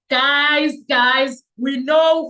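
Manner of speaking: loud call to the viewers, high. Real, not acted. Vlogger calls out to viewers, loud and high. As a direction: grab the audience's attention.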